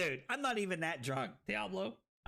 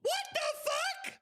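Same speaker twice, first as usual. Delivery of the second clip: very high, squealed shock; alarmed disbelief. Real, not acted. Streamer facing a game loss, alarmed shriek.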